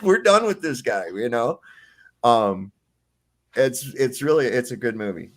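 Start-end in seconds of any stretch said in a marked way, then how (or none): 0.0-1.1 higher, louder and relishing it; gleeful repeat. Retold or imitated. Retelling the villain's dismissal, gleeful and louder. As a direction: savour the line with a laugh in it.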